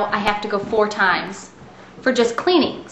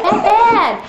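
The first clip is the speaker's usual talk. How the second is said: high, louder laughing bad, bad; emphatic bad; 11 semitones higher, 6 dB louder than usual. Real, not acted. Telling viewers about tooth score, laughing emphasis. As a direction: hammer home how bad it is. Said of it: "No, ninety-nine is bad".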